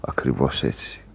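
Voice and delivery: low whisper. Acted. Sailor whispering low beside the sick man. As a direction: let it fade to a whisper. Hushed sickroom talk.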